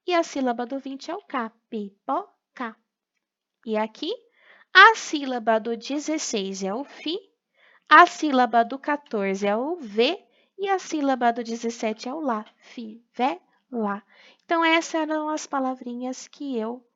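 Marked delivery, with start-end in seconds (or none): 1.7-2.8 "Pipoca" slowly split as pi, po, ca; syllable by syllable. Shown as a demonstration. Joins syllables to form the word. 12.6-14.0 "Fivela" slowly split as fi, ve, la; syllable by syllable. Shown as a demonstration. Joins syllables to form the word.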